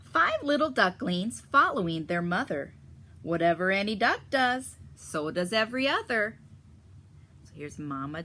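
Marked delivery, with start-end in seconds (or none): 0.1-6.4 bright, lively storybook read-aloud; reading to children. A narrator's reading. Teacher reading the duck story to the class, lively storybook voice. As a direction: read it out like a picture book for kids.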